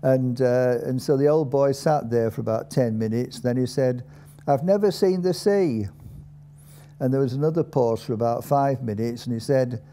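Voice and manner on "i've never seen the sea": put-on higher-pitched old Dales man; terse Dales old-timer, voice lifted; old Dalesman. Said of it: "an old man there".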